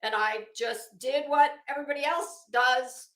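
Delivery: ordinary delivery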